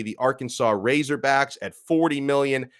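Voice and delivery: usual speaking voice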